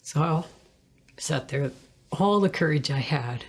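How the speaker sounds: ordinary delivery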